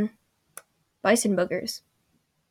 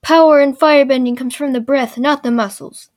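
loud, higher mock-instructor proclamation; wise old man; 3 semitones higher, 8 dB louder than usual. Old man instructing Zuko in firebending, loud mock declaiming. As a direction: declaim it like a mentor's lesson. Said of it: "the wise old man comes up".

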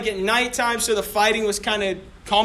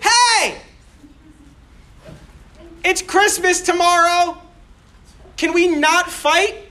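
calling out; 7 semitones higher, slower than usual. Retold or imitated. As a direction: shout across no man's land, hopeful. Soldier calls across trenches.

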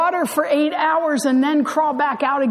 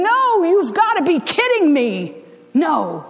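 mock scream; 4 semitones higher than usual. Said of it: "And you scream".

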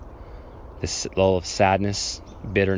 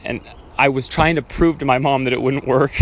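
usual speaking voice

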